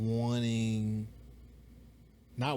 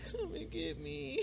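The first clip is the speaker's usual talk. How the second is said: much higher, soft, slow pleading; whiny drunk friend; 14 semitones higher, 10 dB quieter, slower than usual. Retold or imitated. Drunk friend asks for pickup.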